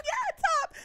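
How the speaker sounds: high-pitched mock cheer; imitated fangirl cheer. Imitating [name] cheering at [name], high excited cheer. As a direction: mimic an oblivious friend cheering, way up high.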